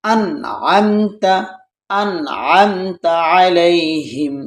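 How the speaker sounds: আনআমতা slow, n said clearly; slow recitation with a clear n; clear izhar. Shown as a demonstration. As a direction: say আনআমতা with a clear n and no nasal hold. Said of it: "ইজহারি হরফ আসছে".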